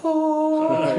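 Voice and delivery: long high "ahh" sound effect; high held note. Pretend. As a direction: hold one high, airy note on request. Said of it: "Is there, like, a sound?".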